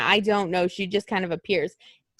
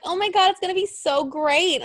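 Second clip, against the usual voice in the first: mock excited fan; 8 semitones higher than usual. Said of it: "everybody else was like".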